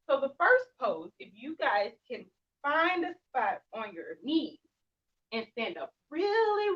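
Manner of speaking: ordinary talk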